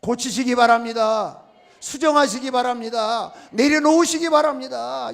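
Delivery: preacher's building plea; emphatic exhortation. Real, not acted. Preacher urging congregation, building.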